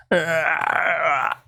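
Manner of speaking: drawn-out creaky groan; imitated biting noise. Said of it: "she's making this ... noise".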